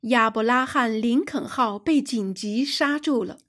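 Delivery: usual speaking voice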